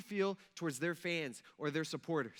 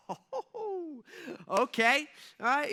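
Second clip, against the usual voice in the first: high, giddy okay with a laugh; mock glee, higher and louder; 8 semitones higher, 5 dB louder, much slower than usual. Imagined Daniel secretly gloating over the king, giddy and laughing. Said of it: "You're like".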